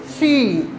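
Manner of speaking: सी repeated with a drawn-out ee; long stressed ee. Shown as a demonstration. Teacher repeating the example for students, सी held long. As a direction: hold सी long, "seee". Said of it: "stress दे के बोलना है".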